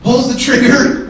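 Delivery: normal talking voice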